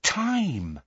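soft, high exclamation gliding down; awestruck. A narrator's reading. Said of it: "in an awestruck voice".

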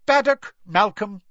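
high, loud roll-call voice; calling out names. A narrator's reading. Professor calling the next student, high and loud. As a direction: call the name across the hall.

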